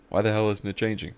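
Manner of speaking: a hint of a cranky grandpa; impatient grandpa. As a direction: complain at the screen impatiently.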